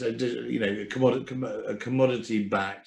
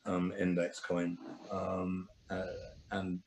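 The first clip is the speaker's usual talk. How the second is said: ordinary talk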